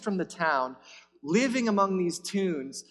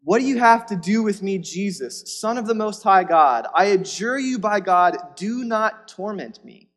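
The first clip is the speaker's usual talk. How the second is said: higher and much louder, desperate; louder demon cry; 4 semitones higher, 7 dB louder than usual. Acted. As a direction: throw the plea at him at full force. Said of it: "Look at his response".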